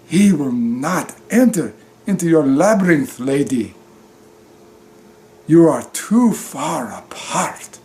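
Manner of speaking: deliberate, ominous mock prophecy.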